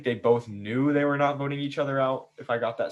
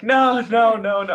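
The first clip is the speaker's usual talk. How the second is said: high, loud flustered denial, laughing; 10 semitones higher, 8 dB louder, slower than usual. Retold or imitated. Host retelling Brooklyn's flustered reaction, high and laughing. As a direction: squeal out the nos like a caught friend. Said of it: "Brooklyn started laughing".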